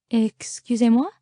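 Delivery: put-on French accent, rising in disbelief; French flight attendant. A narrator's reading. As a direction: question it as a puzzled French attendant. French flight attendant voiced, puzzled by request.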